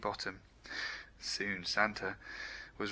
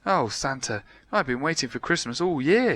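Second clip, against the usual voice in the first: eager; 4 semitones higher, 6 dB louder than usual. A narrator's reading. Eager reply.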